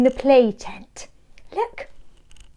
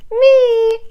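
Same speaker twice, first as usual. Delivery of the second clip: high, louder eager puppy cry; Spot the puppy; 11 semitones higher, 5 dB louder than usual. A narrator's reading. Spot answering the teacher about snacks, high eager puppy voice. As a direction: shout it out like an excited little pup. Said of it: "says Spot".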